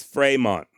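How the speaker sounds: Fremont said "fray-mawn", A vowel, nasal end, no t; French pronunciation. Shown as a demonstration. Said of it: "the way that he and his father would have likely said the name".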